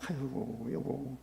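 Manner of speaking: soft, low husband comforting his wife; tender soothing. Acted. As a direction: soothe a grieving wife quietly.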